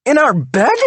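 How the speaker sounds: high, loud horrified cry; horrified outrage. A narrator's reading. Wife horrified affair was in their bed, outraged. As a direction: cry out in disgust.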